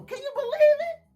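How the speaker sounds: usual speaking voice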